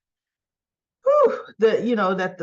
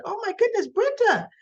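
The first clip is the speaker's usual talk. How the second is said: mother's shocked cry, pitched much higher; 12 semitones higher than usual. Retold or imitated. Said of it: "and her mother's like".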